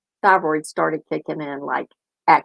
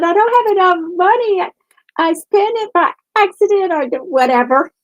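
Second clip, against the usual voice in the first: much higher, whiny daughter voice; imitating daughter; 11 semitones higher than usual. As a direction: mimic the daughter's helpless excuse. Daughter complaining about money.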